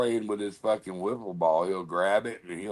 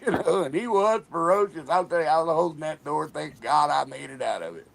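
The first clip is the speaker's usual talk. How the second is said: normal talking voice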